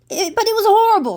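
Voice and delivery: high, fast complaining voice; whiny sister. A narrator's reading. Maddie's sister complaining to Maddie, high and whiny. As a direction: moan it like a sulky big sister. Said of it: "said Maddie's sister".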